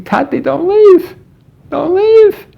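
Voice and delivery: put-on very high, stretched-out child's wail; screaming son imitated. Son as father drops him off, imitated high scream. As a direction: mimic a boy desperately begging, each plea drawn out. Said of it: "the son starts screaming".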